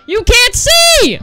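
slow, high and loud disbelief; outraged shout. Real, not acted. Gamer blinded by the camera, outraged shout. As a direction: yell each word out in outrage.

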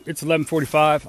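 ordinary talk

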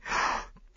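shocked intake of breath; sharp gasp. Acted. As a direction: gasp in outrage. Maid reacts to accusation.

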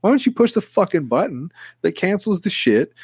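rising, high indignant tirade; hammed-up fury cracking high at the end; mock rant. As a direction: tear into the agent, voice climbing. Imagined rant at support agent.

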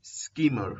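"स्कीमर" opening on a hissed S; hissing S. Shown as a demonstration. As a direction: begin "स्कीमर" with a clean hissing s, no vowel before it. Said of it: "यहाँ पे भी hissing sound". ("स्कीमर" के शुरू में स की सिसकारी वाली आवाज़)

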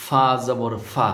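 slow lesson demo of ফা জবর ফা; slow careful pronunciation. Shown as a demonstration. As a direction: say ফা slowly with the teeth on the lip. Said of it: "এভাবে".

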